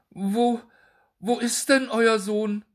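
high, loud panicked pleading; trembling voice. A narrator's reading. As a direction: beg for the answer, voice shaking. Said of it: "mit zitternder Stimme". (zitternde, flehende Stimme)

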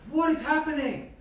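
high, loud "what is happening"; baffled outburst. Retold or imitated. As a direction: blurt it out, totally lost. Confused reader of the prophets.

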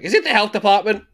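high, quick mock voice of a flustered old lady; mock Mama Luna. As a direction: pipe it up high like a startled cat lady. Mocking Mama Luna at the door.

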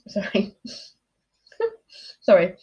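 laughing it off after nearly choking; sputtering, giggling sorry; laughing apology. Real, not acted.

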